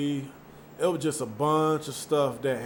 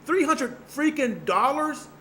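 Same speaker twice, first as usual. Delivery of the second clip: high, outraged disbelief at the bill; mock shocked, voice jumping up; 9 semitones higher than usual. Reacting to a three hundred dollar bill, high outraged disbelief. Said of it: "I'm like".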